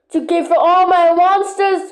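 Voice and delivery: normal talking voice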